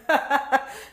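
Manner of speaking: short hearty laugh at herself; amused laugh after a joke about herself. Real, not acted. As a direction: laugh out loud.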